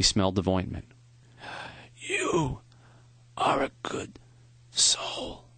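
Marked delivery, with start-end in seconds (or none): 2.0-5.5 thin whispered rasp, short bursts; a dying professor's raspy whisper. A narrator's reading. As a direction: breathe the words out like a dying man. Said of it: "His voice was thin and raspy".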